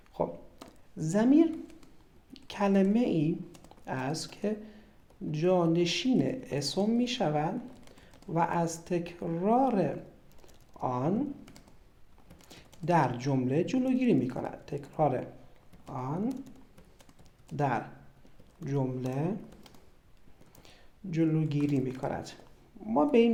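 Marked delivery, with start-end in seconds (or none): 1.0-14.6 slow dictation. Shown as a demonstration. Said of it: "dictating definition slowly". 14.7-22.3 repeated dictation. Shown as a demonstration. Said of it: "repeating dictation".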